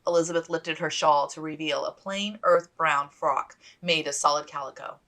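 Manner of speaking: plain reading voice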